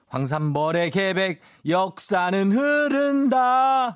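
dramatic movie line. Pretend. Quiz answer, dramatic quoted line.

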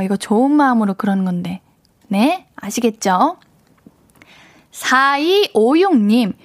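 normal talking voice

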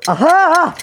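high excited exclamation; excited cry. Real, not acted. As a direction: yelp as the trick works. Reaction during the game. (신나서 외치는 아하)

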